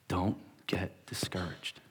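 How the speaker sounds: slow, soft, breathy plea; an older man with tears urging him on; tearful whisper. Retold or imitated. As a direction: whisper it with quiet urgency. Remembered words in head.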